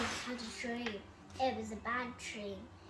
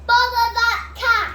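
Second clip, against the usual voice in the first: loud, high fed-up cry; exasperated outburst; 11 semitones higher, 20 dB louder than usual. A narrator's reading. Mister Thomas fed up with the cat, loud high outburst. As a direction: snap it out at the cat. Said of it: "Mister Thomas said".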